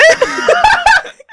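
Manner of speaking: high shrieking laugh; a real, loud shrieking cackle; cracking up at a co-host's Kermit voice with a shrieking laugh; loud burst of laughter. Real, not acted.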